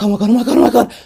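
breakless excited chant; acted burst of giddy excitement; adrenaline rush. Showing adrenaline when staying excited, mock giddy. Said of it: "that adrenaline".

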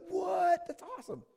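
high, loud astonished yelp; hammed-up awestruck outburst; amazed reaction. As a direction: blurt it out in acted amazement. Acts out amazed reaction to miracle.

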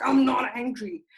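loud, slow, forced "not angry"; mock insistent denial of anger. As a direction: insist through tight control that you're fine. Acting out suppressing her anger.

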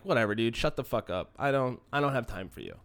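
low, soft brush-off; dismissive. Retold or imitated. Dog owner silently brushing off hecklers, dismissive. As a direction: brush them off under your breath. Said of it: "You just gotta be like".

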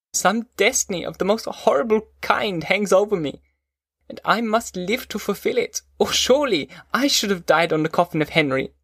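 high-pitched, anguished declaiming; tearful lament. A narrator's reading. Weeping son telling father of his destiny, anguished lament. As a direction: bewail a doomed fate, pitched high. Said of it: "I shed tears".

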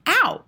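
high, loud cry of pain; sudden piercing yelp. A narrator's reading. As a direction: yell as if jabbed with a pin. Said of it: "and he yelled".

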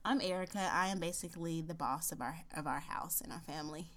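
ordinary delivery

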